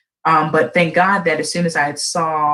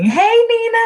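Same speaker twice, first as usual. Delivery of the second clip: much higher, louder greeting; bright stream hello; excited greeting; 5 dB louder, slower than usual. Real, not acted. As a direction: call out to a friend joining. Greeting live viewers.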